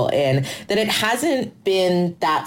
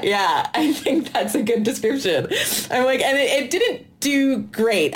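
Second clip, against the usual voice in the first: laughing while talking; 7 semitones higher than usual. Real, not acted. Laughing at the Queer Eye joke.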